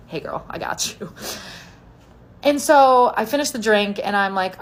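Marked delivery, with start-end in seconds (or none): 0.1-1.1 hushed, near-whispered aside; conspiratorial. Retold or imitated. Stranger at the bar secretly backing her up, hushed. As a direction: say it softly, woman to woman. Woman covertly helping another.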